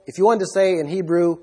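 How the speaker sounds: ordinary talk, nothing marked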